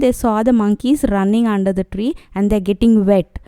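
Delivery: normal talking voice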